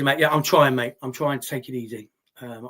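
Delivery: usual speaking voice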